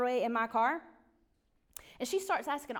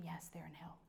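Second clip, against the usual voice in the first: unvoiced whisper, much softer; 6 semitones lower, 17 dB quieter than usual. Real, not acted. Telling her friend her family is in hell, whispered. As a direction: breathe it out as a hard truth.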